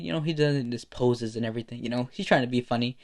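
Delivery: ordinary delivery, nothing marked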